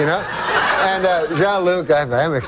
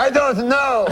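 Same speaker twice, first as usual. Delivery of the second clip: put-on higher, drawn-out French-Canadian accent; Jean-Luc; 8 semitones higher, slower than usual. Uncle stumped by the cabbie's riddle, slow French-Canadian accent. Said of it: "my uncle goes".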